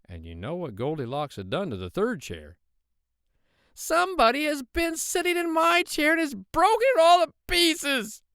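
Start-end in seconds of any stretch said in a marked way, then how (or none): 3.7-8.2 little shrill bear wailing loudly; very high, loud, shrill tiny-bear voice; Baby Bear. A narrator's reading. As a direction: squeal it out like an upset cub. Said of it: "said Baby Bear in his little shrill voice".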